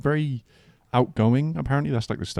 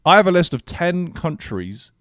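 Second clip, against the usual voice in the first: hyped presenter; 8 semitones higher, 7 dB louder than usual. Shown as a demonstration. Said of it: "let me do that with more energy".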